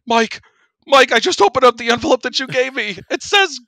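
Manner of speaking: imitated freak-out, very high and loud; Danielle. Coworker phoning the prankster about the wrong ball, mock panic. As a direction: shout it in a mock panic, pitch climbing. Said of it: "she was freaking, obviously freaking out".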